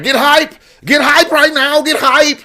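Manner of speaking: high-pitched mock hype man; high, urgent pep-up chant; hyped-up presenter. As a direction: imitate an over-eager crowd rouser. Imitating presenter hyping up the crowd.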